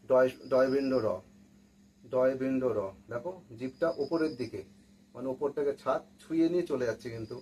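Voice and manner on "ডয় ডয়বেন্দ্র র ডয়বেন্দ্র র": ডয়বেন্দ্র র said slowly with the tongue curled back; ড় sound demo. Shown as a demonstration. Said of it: "যখন আমরা দেখব". (ড় ধ্বনি স্পষ্ট করে উচ্চারণ)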